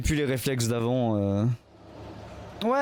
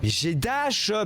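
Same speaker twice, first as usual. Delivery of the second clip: frustrated shout, much higher; angry shout; 8 semitones higher, slower than usual. Real, not acted. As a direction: yell the protest at the game. Frustrated protest at game.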